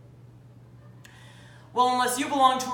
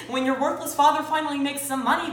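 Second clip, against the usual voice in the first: normal talking voice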